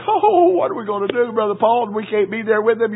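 high, worried mock wail; fretting church folk. Churchgoers fretting over Paul's letter, high mock worry. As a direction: play the anxious churchgoers.

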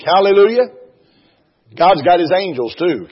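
high, loud fervent preaching; preaching at full force. Real, not acted. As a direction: lift the congregation, ringing out. Preaching climax.

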